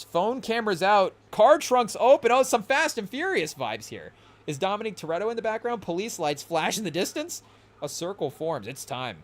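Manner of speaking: hyped scene-setting voice, building; movie scene narration. Acted. Streamer setting the battle scene for chat, hyped narration. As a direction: narrate it like a trailer.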